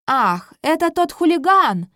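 loud high king exclaiming; booming warrior king. Acted. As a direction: blurt it out with a cough. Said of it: "закашлявшись, говорит король Марк".

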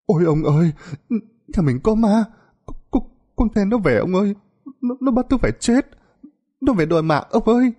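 very high, stammering fright; frantic, terrified babble. Acted. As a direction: blurt it out in panic to her husband. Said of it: "Bà ôm chầm lấy ông mà rối rít". (giọng rối rít, hoảng sợ, lắp bắp)

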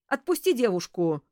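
a loud, firm order; megaphone command. A narrator's reading. Police ordering the strangler to release the girl, loud command. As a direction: call it across a clearing to an armed man. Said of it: "прогремел над поляной усиленный мегафоном голос".

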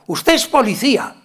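high, loud accusing yell; retold shout. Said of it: "y gritó".

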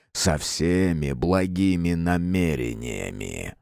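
low, slow purring demon voice; purring dark lord. Acted. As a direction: purr it slyly, deep and slow. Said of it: "темнейший проурчал".

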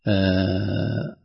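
ordinary talk

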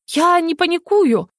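high, slow indignant denial; protest, offended at the word panic. A narrator's reading. Heroine denying panic to the mage, high indignant. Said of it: "возмутилась".

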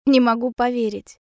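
usual speaking voice

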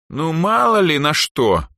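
high, slow, drawn-out evasion; drawling. A narrator's reading. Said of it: "протянула Эльза".

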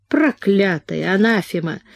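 ordinary delivery, nothing marked

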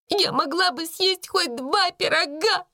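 very high, tearful wail; acted desperate protest; despairing. A narrator's reading. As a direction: wail it like a wronged servant girl. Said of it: "в отчаянии проговорила она".

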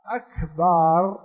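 slow أكبر with the ba drawn into a long a; stretched ba. Shown as a demonstration. Teacher demonstrates the wrong drawn أكبر. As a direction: lengthen the ba of أكبر. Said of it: "كأنه يقول: كبر وكبر وكبر".

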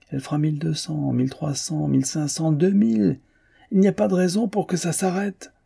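high-pitched cry climbing with each figure; excited calling out. A narrator's reading. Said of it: "Guillemard criait à Molina". (cri exalté, de plus en plus aigu)

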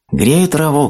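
very high, mild storybook shout; high-pitched hare raising his voice; hare calling back. A narrator's reading. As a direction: call it out to a distant listener. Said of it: "крикнул заяц".

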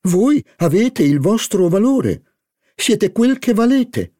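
high, gushing flattery of a society hostess. Acted. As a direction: lay the flattery on thick, up high. Madame Verdurin flatters Charlie.

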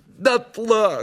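high, theatrical lament; sorrowful. A narrator's reading. Koroviev confirming the house burned down, sorrowful high voice. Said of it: "горестно подтвердил Коровьев".

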